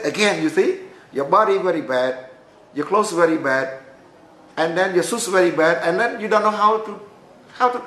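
a boss listing everything wrong with you, rising; exasperated scolding. Retold or imitated. Director scolds his clothes, ignorance.

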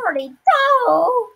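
high, slow excited yell; excited shout. Real, not acted. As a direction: shout the number with glee. Louder repeat of count.

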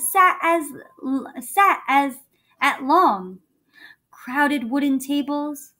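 lively storybook reading; reading to children. A narrator's reading. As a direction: read it like a bedtime story.

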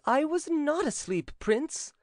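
high-pitched indignant servant; bold protest. A narrator's reading. Servant denying to the prince he slept, bold protest. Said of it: "cried the servant boldly".

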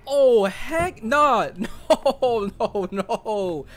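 high, slow frightened nah with a nervous laugh; backing away in real fright; scared refusal. Real, not acted. Spooked player refusing the creepy figure, high and scared.

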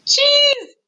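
high, loud, stretched squeal; high-pitched gleeful exclamation; squealed reveal. A narrator's reading. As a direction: shout the punchline up high. Chimes the story's refrain.

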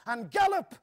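high, loud urgent cry; gasped shout. A narrator's reading. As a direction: yell the spur of the last stretch. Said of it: "gasped Joris".

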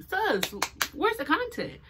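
high-pitched imitation of a follower asking; impatient follower. Followers nagging her for new posts, mock impatient. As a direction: mimic a waiting fan, voice pitched well up.

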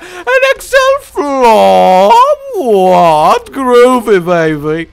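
loud, high British swinger voice; loud, hammed-up British swinger drawl; Austin Powers. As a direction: ham up a groovy Sixties spy. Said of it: "that needed an Austin Powers".

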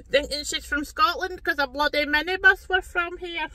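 put-on high, loud Scottish accent through laughter; mock Scottish accent. Glasgow viewer mocking her accent, laughing mock Scottish. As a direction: scoff in hammed-up Scottish, laughing. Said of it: "talking bloody Scottish".

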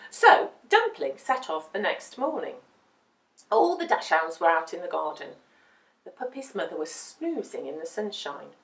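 plain reading voice

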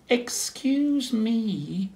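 high, very slow, shy little penguin; hesitant polite call up the hill; little penguin working up courage; timid penguin. Acted.

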